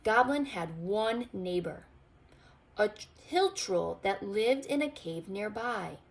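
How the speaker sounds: ordinary delivery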